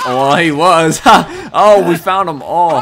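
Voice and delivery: rising into a loud, high exclamation; delighted surprise. Real, not acted. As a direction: burst out at a lucky discovery. Surprised at finding the hiding players.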